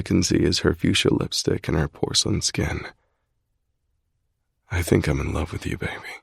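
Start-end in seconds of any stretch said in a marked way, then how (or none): 4.7-6.1 soft tender confession. A narrator's reading. Love confession.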